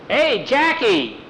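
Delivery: high, drawn-out hail; calling out. A narrator's reading. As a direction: call out to a friend down the drive. Rider calls out on approach.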